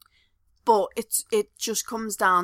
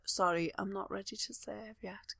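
mock clipped politeness; 14 dB quieter than usual. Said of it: "I'd be like".

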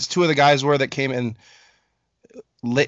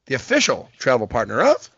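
ordinary delivery

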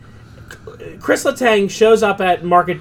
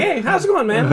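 friendly greeting; 10 semitones higher than usual. Retold or imitated. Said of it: "He was like".

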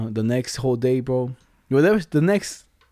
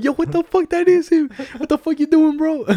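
high, loud mock outrage; imitated disbelief; 13 semitones higher, 4 dB louder than usual. Friends of the phone owner see Dax in the video, high shocked yell. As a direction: shriek it like you can't believe it.

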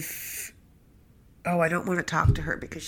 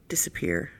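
breathy whisper, almost to herself; 11 semitones lower, 6 dB quieter than usual. Real, not acted. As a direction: let the word fall away in a whisper. Whispered echo of her wish.

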